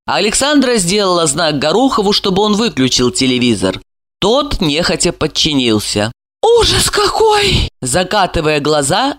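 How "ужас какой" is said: high, drawn-out indignant outcry; scandalized high exclamation. A narrator's reading. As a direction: voice an outraged woman, pitched way up. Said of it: "возмутилась она".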